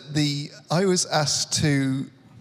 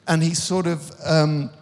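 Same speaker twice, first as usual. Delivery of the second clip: ordinary talk, nothing marked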